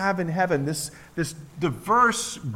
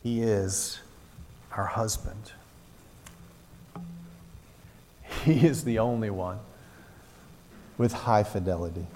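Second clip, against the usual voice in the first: lower, softer, with long pauses; hushed pastoral appeal; intimate quiet preaching; 9 semitones lower, 4 dB quieter than usual. Acted. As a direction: draw the church in close and gently. Intimate close of the sermon.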